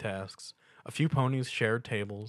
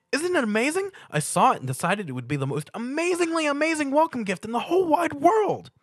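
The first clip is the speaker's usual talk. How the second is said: very high, loud bubbly pony voice; Pinkie Pie; 6 dB louder than usual. A narrator's reading. Pinkie Pie greeting Stuart with a loud high squeal. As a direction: squeal the welcome like an overexcited pony.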